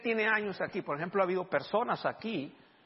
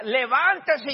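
loud, higher command to get up; commanding shout; 8 semitones higher, 9 dB louder, much slower than usual. Retold or imitated. Preacher urging the brother from his wheelchair, loud command. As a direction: order him out of the chair.

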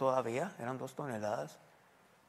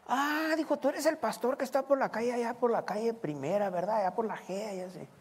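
high eager voice; hammed-up excited stranger; excited recognition; 7 semitones higher than usual. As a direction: mimic a man who just placed you. Man recognizes pastor excitedly.

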